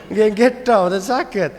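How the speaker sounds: high, put-on meek voice; imitating over-polite Javanese; refined, gentle speaker. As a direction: plead politely that you can't be firmer. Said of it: "ngomongnya tuh halus, kromo pisan".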